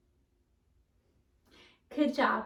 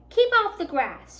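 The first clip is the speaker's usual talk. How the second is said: much higher, loud angry shout; 9 semitones higher, 10 dB louder than usual. A narrator's reading. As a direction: yell it across the yard. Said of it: "shouted Hank".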